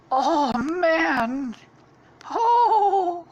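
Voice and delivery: very high, loud cartoon exclamations; weird character noises. Stampy making weird noises, high loud cartoon voice. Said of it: "making a bunch of weird noises".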